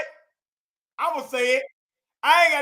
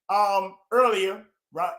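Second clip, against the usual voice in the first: usual speaking voice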